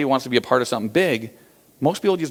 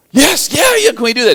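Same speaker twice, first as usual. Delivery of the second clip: high, loud, thrilled yes yes; excited yes; 13 semitones higher, 8 dB louder than usual. Retold or imitated. Asking the fiberglass maker for the giant cup, thrilled and loud. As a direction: burst out with delight at the sketch.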